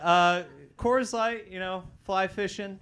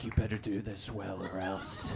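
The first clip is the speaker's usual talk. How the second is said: lower mock stern dad; 8 semitones lower, 5 dB quieter than usual. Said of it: "my dad's just like".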